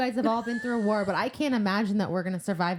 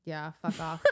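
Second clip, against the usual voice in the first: low, quiet laugh through words; laughing scoff; 7 semitones lower, 7 dB quieter than usual. Real, not acted. Friend laughing off a ripoff, quiet scoff.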